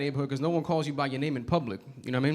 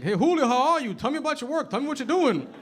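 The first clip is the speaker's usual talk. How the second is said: high, friendly landlord checking in; 10 semitones higher than usual. Retold or imitated. Landlord asking dad about his work, high friendly voice. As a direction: play the cheerful landlord. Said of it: "He's like".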